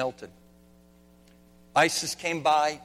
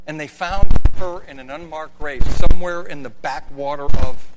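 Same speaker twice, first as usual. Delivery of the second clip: rising to a loud, forceful preach; loud building sermon; 14 dB louder than usual. Real, not acted. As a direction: proclaim it at full force.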